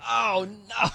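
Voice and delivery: high, drawn-out cry of dismay; mock dismay. Bettor hearing the late whistle, mock dismay. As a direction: wail it like the bet just died.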